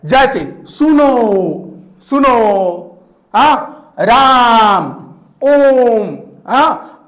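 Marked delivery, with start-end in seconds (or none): 0.7-3.0 सुनो stretched slowly like calling someone; drawn-out call. Shown as a demonstration. Teacher calling a student, drawn-out सुनो. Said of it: "जैसे क्या बताया जा रहा है". 4.0-6.2 राम and ओम called out louder, vowels held long; calling out. Shown as a demonstration. Teacher showing how to call someone, drawn-out राम. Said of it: "ऐसे करके".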